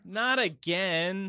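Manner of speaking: drawn-out, resigned; slow weary catchphrase. Acted. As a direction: stretch it out, tired and done. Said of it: "That's my intro line".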